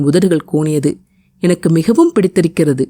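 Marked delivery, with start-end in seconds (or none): none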